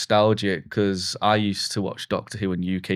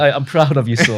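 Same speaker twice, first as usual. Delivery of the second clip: louder, doting dad voice; beaming proud parent; 4 semitones higher, 5 dB louder than usual. Retold or imitated. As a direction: swell with pride like a dad. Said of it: "I am a proud dad".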